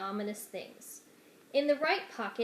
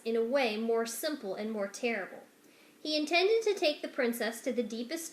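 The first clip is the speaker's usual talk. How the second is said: usual speaking voice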